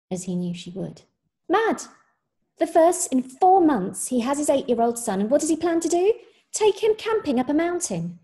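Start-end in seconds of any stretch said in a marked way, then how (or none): 1.5-8.2 high, loud scornful rant; mock the father's plan with scorn; mocking mother. A narrator's reading. Mother mocking the father to her boyfriend, scornful rant. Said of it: "Her voice was low and light and mocking".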